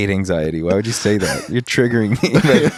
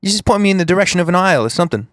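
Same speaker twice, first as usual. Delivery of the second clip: put-on British accent; 6 semitones higher, much faster than usual. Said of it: "a foreign exchange student from Britain".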